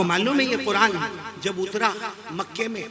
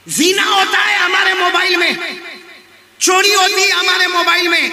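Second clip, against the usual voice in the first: high, forceful sermon delivery; fiery preaching; 10 semitones higher, 8 dB louder than usual. Real, not acted. Preacher rebuking the crowd over phone sins, fiery. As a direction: hammer out each charge at raised pitch.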